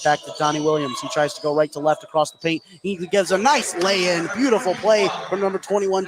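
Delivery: ordinary delivery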